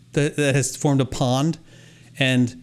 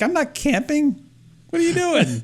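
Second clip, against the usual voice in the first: mock indignant diner; 11 semitones higher than usual. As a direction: play the baffled customer, pitched way up. Mock outrage at cook-your-own restaurant.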